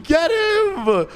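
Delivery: high-pitched, frantic calling out; urgent chase. Acted. Cop chase acted out.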